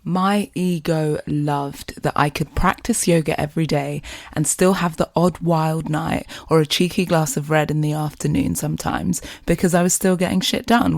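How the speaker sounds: ordinary talk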